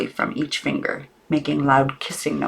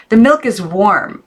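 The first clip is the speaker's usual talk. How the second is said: calm aunt; 6 semitones higher, 5 dB louder than usual. A narrator's reading. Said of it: "not raising her voice".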